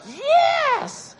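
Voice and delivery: very high, loud squeal of triumph; triumphant cheer. Retold or imitated. Speaker cheering the girl's breakthrough, elated. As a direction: cheer it like a win, stretched out. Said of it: "And I was like".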